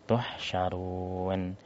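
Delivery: "Tuhsyarun" said slow and soft, letters stopped; sukun demo. Shown as a demonstration. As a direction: draw out "Tuhsyarun" with still consonants. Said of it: "Sukunkan semuanya". ("Tuhsyarun" dibaca pelan dengan sukun)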